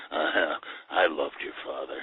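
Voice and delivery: low, soft deadpan impression; Jack Palance. Gunman answering Zero after the shot, low imitated drawl. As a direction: drop low and quiet, imitating a laconic actor. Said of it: "he was very Jack Palance".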